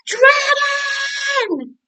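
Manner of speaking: very high, loud shout into his ear; piercing high shout. Acted. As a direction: yell to wake a sleeping dragon. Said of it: "she shouted".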